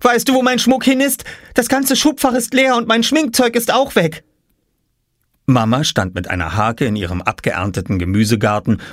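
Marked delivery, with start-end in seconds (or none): 0.0-4.2 put-on very high, upset girl voice; distressed high girlish plea. A narrator's reading. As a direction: complain to mum about the theft.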